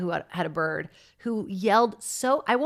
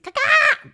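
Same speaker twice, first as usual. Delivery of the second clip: bird squawk; 10 dB louder than usual. Retold or imitated. Imitating the neighbour's yelling bird.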